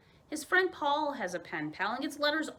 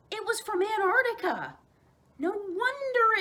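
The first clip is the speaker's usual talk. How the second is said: high, louder amazed reveal; surprised; 11 semitones higher, 5 dB louder than usual. A narrator's reading. Narrator revealing the letter's origin, high amazed. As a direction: reveal it with wonder.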